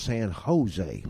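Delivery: San Jose said slowly, San Ho-ZAY; slow correction, San Jose with the J as H. Retold or imitated. A Westerner teaching the dad, slow San Ho-ZAY. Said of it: "We pronounce it like an H".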